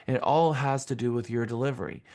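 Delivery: ordinary talk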